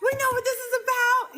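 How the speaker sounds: high squealing caring voice, laughing through it; high laughing impression of cheerful nurses; gushing high mock voice. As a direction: play the overjoyed lab staff, squeaky and giddy. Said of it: "the LabCorp I was going to was even telling me".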